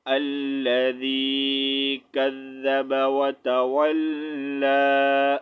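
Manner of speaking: very slow, level recitation with held vowels; measured Quran recitation. Shown as a demonstration. Teacher joining spelled letters for students, slow recitation. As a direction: recite slowly on one note, stretching long vowels.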